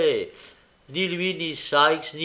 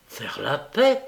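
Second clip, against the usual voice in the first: incredulous echo, rising at the end; indignant disbelief; exasperated; 2 semitones higher, 4 dB louder, slower than usual. A narrator's reading. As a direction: throw the words back, mildly exasperated. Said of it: "s'écria Nancy exaspérée".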